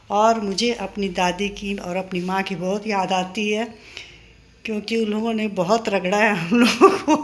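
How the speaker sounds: normal talking voice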